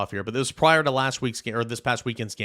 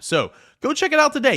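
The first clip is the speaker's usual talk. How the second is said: hyped ad push; 13 semitones higher, 6 dB louder than usual. Acted. Ad read, hyped close.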